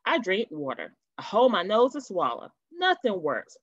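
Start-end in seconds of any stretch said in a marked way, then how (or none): none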